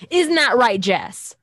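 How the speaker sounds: performed punchy sign-off; hammed-up call-out. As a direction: throw the question out big at Jess. Reading movie quote, calling to Jess.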